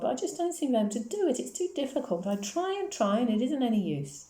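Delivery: put-on high, plaintive child's wail; tearful girl giving up, voice pitched right up; sobbed. A narrator's reading. Upset girl telling her mother it's hopeless, sobbed. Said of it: "she sobbed".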